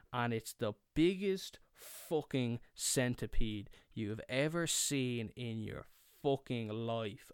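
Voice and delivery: normal talking voice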